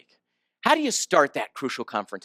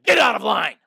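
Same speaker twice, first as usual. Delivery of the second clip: loud angry shout, higher in pitch; retold scream; 5 semitones higher, 4 dB louder than usual. Angry man in line to the woman who cut in, screaming. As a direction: scream it at someone's back. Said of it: "He screams at the back of her head".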